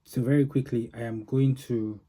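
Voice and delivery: normal talking voice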